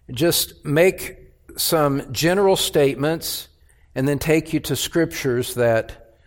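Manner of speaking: ordinary delivery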